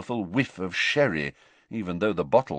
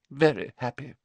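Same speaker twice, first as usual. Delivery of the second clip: sniffy; 6 semitones higher than usual. A narrator's reading. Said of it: "said Professor Trelawney sniffily".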